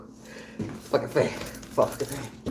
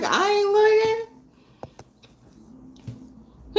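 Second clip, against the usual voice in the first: very high, very slow drawn-out refusal; stubborn refusal; 4 dB louder, much slower than usual. Retold or imitated. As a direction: stretch it high and long, refusing. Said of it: "well, I was like".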